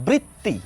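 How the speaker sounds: বৃত্তি with a doubled tt, britti; second step of the drill; step two demo. Shown as a demonstration. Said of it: "তয় ত করে দিলে কেমন হবে".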